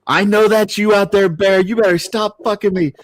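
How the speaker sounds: high, loud outraged lion voice; angry lion. Acted. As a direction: yell it as the indignant stuck lion. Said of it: "lion's going".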